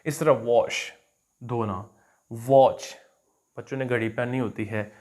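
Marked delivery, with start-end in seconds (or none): 0.3-0.9 वॉश with rounded lips; वॉश, round short o. Shown as a demonstration. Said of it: "lips round करके बोलना". 2.3-3.0 वॉच with rounded lips, stressed; वॉच, round o not flat aa. Shown as a demonstration. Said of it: "वॉच नहीं इसको सीधा सीधा कहना".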